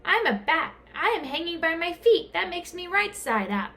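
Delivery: high bat insisting; young bat voice. A narrator's reading. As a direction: insist like a small stubborn bat. Bat character speaks.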